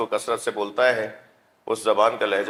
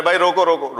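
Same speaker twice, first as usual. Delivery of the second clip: calling to stop; 5 semitones higher, 5 dB louder than usual. Retold or imitated. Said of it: "کہنے لگے".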